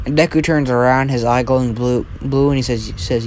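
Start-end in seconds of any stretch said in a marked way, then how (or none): none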